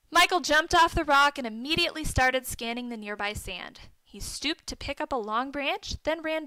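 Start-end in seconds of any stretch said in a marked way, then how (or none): none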